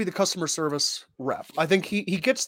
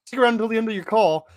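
rapid disclaimer read; 6 semitones higher, 3 dB louder, much faster than usual. Acted. Acting phone menu promo.

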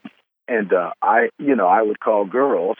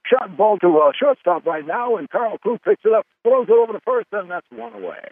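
higher, excited sportscaster call; play-by-play announcer; 11 semitones higher than usual. Retold or imitated. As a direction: call it like a kid doing radio play-by-play. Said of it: "I would say ... mimic play-by-play announcers".

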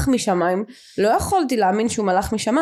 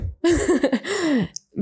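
disbelieving laugh; 7 semitones higher than usual. Real, not acted. Laughs at her own shock.

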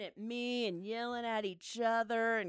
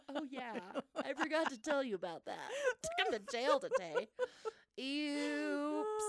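casual at first, climbing to a high squeaky oops; sheepish confession; 4 semitones higher than usual. Retold or imitated. Mom admits jail trip to husband, sheepishly. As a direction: play it light and guilty, rising higher.

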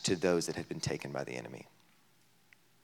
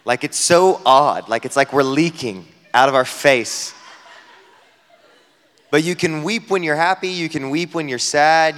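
louder, higher sermon build; fervent preaching; 6 semitones higher, 8 dB louder than usual. Acted. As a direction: preach it with rising conviction. Preaching at full force on weeping.

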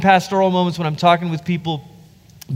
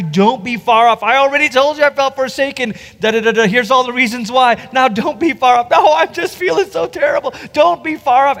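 higher, pleading, spiraling voice of the psalmist; David's anguished plea; 7 semitones higher than usual. Retold or imitated. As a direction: beg God not to stay far off, getting more desperate.

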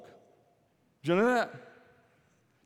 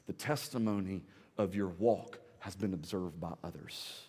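soft, low pulpit plea, near a whisper at times; tender, hushed preaching; hushed appeal; 5 semitones lower, 7 dB quieter than usual. Acted. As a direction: draw them in quietly. Sermon drops to hushed appeal.

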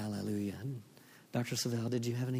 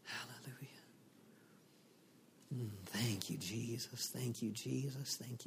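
soft whispered hallelujah; whispered praise; 11 dB quieter than usual. Real, not acted. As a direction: breathe it softly.

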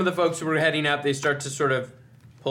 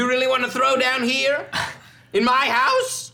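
high indignant merchant, slowing down; 10 semitones higher, 4 dB louder than usual. Acted. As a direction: lean in on in my house. Merchant NPC repeats challenge.

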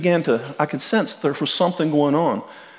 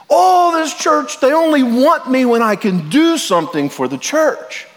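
angry outburst; 10 semitones higher, 4 dB louder than usual. Retold or imitated. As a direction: act out someone blowing up at the pastor, loud and high. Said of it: "blew up, got red in the face".

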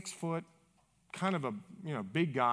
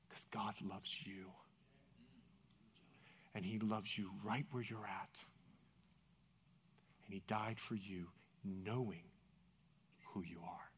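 low, very soft and earnest; tender reassurance; 9 semitones lower, 17 dB quieter than usual. Retold or imitated. Pastor telling the man God loves him, tender hush. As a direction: speak low and gently, leaving space between phrases.

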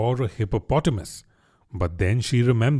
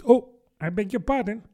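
higher, abrupt apology; mildly startled, pitched-up regret; apologetic cry; 8 semitones higher than usual. A narrator's reading. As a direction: catch yourself and apologise at once. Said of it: "cried Alice again".

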